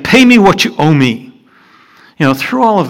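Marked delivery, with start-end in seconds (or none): 0.0-1.2 firm demand, a bit louder; mild mock demand; demanding servant. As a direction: press him for the money.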